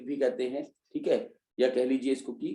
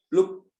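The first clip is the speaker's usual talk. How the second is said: प्लुप्त said louder and higher, punched; stressed term; 5 semitones higher, 4 dB louder than usual. Shown as a demonstration. Teacher stressing the new term प्लुप्त to students. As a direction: hit प्लुप्त as the key term. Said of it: "अधिक लंबा खींचते हैं".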